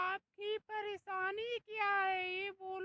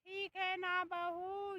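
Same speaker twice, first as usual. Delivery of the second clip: ordinary delivery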